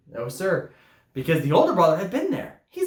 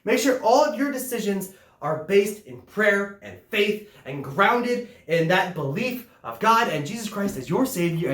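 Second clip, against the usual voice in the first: ordinary talk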